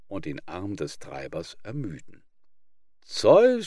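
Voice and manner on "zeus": high, loud cry of the name; the donkeys call out to Zeus; donkeys shouting. Acted. Donkeys loudly crying out to Zeus. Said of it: "schrien die Esel".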